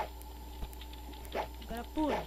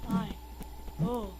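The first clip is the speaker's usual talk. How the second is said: ordinary talk, nothing marked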